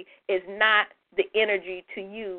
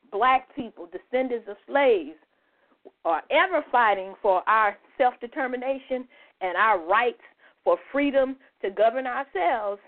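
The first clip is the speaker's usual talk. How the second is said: ordinary delivery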